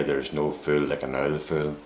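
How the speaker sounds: put-on Northern Irish "There's no fool like an old fool", with "like" said as "ike" and "old" as "ould"; local Northern Irish accent. Shown as a demonstration. Northern Irish man giving the saying as locals say it, in a local Northern Irish accent. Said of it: "as we would say here".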